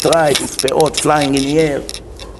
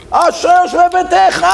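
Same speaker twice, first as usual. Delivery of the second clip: mock praying shout; 12 semitones higher than usual. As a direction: belt out the prayer, stretching the last vowel. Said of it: "Like this".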